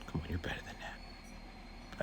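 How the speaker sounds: soft whispered encouragement; disappointed whisper. Retold or imitated. Aide quietly telling the students they can do better, in a whisper. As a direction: whisper it, let down but kind.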